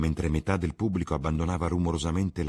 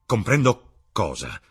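slow, louder, furious demand; furious question; 4 semitones higher, 8 dB louder than usual. Acted. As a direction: bite off each word in rage. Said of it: "chiese lui, furente". (Dorian chiede, furente)

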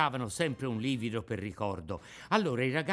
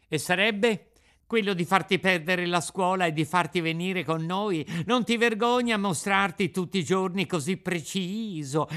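put-on higher, louder jeering boy voice; mocking street urchins, raised pitch; taunting schoolboys; 6 semitones higher, 5 dB louder than usual. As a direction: needle him like a gang of brats. Boys taunting Pinocchio.

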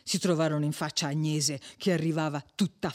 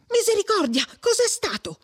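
high-pitched startled exclamation; alarmed cry; 3 dB louder than usual. A narrator's reading. Perpetua hears her master yelling for help, alarmed cry. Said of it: "gridò Perpetua".

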